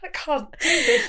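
mock potato voice. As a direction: mimic a swollen-throated plea teasingly. Friend mimics the potato voice.

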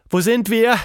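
high, loud startled shout; alarmed cry. Acted. Girl finds herself high above a drain, crying out. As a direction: cry out in alarm, pitched right up. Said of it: "schrie Tilda".

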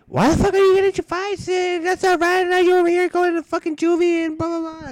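very high, loud mock mother; imitated scolding mom. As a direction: rant in a put-on high nagging voice.

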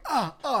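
high mock yelps of pain; short yelping cries, hamming the pain up. Retold hopping in pain.

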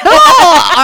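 high, loud mock Australian exclamation; Australian accent. Shown as a demonstration. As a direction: belt it out as a badly faked Australian. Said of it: "Australian, or something like that".

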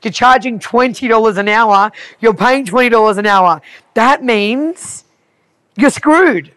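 loud, high, emphatic spelling-out; blunt bad news. Retelling what to tell a failing business owner, blunt. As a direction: hammer each point, climbing to the verdict.

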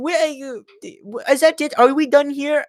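high-pitched imitated Indian-sounding accent. Embassy man presses students to leave, high imitated Indian-sounding accent. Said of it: "there's an Indian guy telling them to leave".

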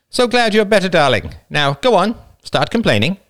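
the wife, mock-sweet and teasing. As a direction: tease him fondly as his wife. Wife voiced in the story.

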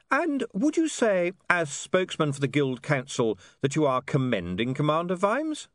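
high, deliberate leading question; eager interviewer fishing for a quote; reporter William, pressing sweetly. Acted. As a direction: lift the voice and press on innocently. William's held character voice.

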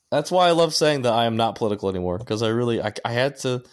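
usual speaking voice

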